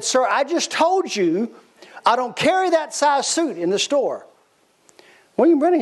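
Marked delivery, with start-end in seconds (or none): 0.0-4.3 higher, slow, losing patience; irritated shopkeeper. Retold or imitated. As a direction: spell it out to a pushy customer, higher and slower. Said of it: "now the man's starting to get a little angry".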